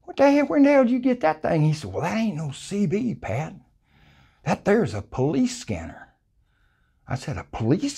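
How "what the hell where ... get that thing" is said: ordinary delivery, nothing marked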